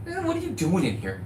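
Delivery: light put-on bank-teller voice; imitating bankers' voice. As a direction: mimic how they talk, not like you. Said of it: "they don't talk like me".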